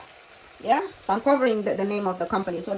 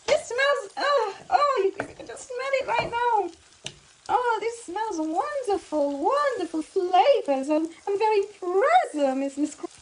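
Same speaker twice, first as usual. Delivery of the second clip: high-pitched, drawn-out rapture over food; swooning high over the aroma; gushing delight; 11 semitones higher, 4 dB louder, slower than usual. Pretend. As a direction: gush like an impressed food critic. Gushing over her own cooking smell.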